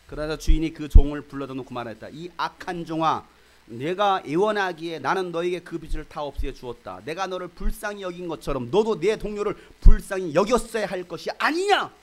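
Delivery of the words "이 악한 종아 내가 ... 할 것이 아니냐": a stern master's loud scolding, slowing down; rebuke. Retold or imitated. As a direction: scold the wicked servant. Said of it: "호통을 치신 것이죠".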